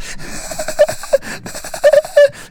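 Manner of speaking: high relentless mock giggle; imitated laughter. Laughter not stopping in his head, high cackle. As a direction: keep a very high cackle going. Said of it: "It's not stopping".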